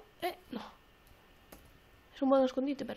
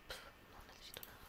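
breathy whisper to yourself; hushed, barely voiced dismissal; whispered aside; 24 dB quieter than usual. Real, not acted. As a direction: whisper it under your breath. Muttered aside to self.

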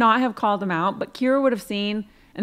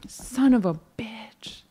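whispered curse; 3 semitones lower, 4 dB quieter than usual. Real, not acted. Curses after his mistake.

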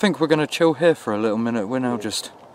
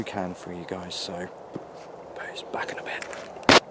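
whispered goodbye, barely voiced; whispered sign-off; 5 semitones lower, 8 dB quieter than usual. Real, not acted. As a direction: breathe a quiet goodbye.

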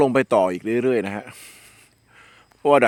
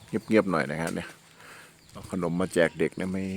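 hushed; 6 semitones lower, 9 dB quieter than usual. Real, not acted.

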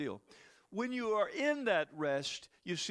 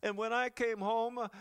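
ordinary talk, nothing marked

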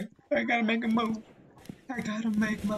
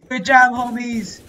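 loud, high cheer for the team; loud praise; 6 semitones higher, 11 dB louder than usual. Real, not acted. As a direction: hype up the squad. Praising team after kill.

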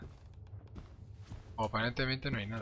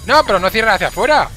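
screaming in panic; loud frantic yell, high; panicking gamer; 8 semitones higher, 17 dB louder than usual. Real, not acted. Panicked yelling at game.

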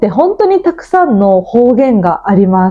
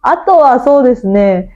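ordinary talk

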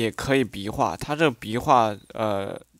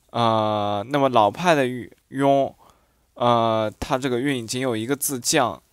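ordinary talk

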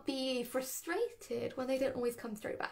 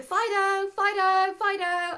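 calling the whippet's name, higher and louder; calling the dog; 6 semitones higher, 6 dB louder, slower than usual. Shown as a demonstration. Trainer calling a whippet by name, louder and higher. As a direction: call the dog's name across the park. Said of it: "so you might be going".

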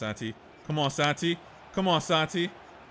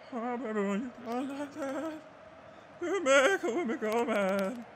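a lightly voiced, higher mock [name], softer and crooning; imitating [name]; 6 semitones higher, 5 dB quieter than usual. Gamer teasing friend about PK's wife, mock [name] voice. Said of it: "That's how she sounds, right?".

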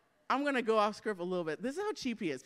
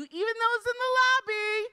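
high, loud call to the far seats; 13 semitones higher, 6 dB louder than usual. Real, not acted. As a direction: call out to people out in the lobby, pitched way up. Calls out to lobby audience.

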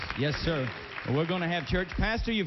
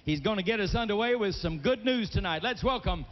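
announcer intro; 4 semitones higher than usual. Acted. Host brings on [name], big showman call. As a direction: build it up like introducing a legend.

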